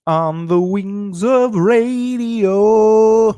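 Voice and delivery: drawn-out, grand, slow and high; announcer voice. Acted. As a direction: announce it like a movie title. Grand dramatic title.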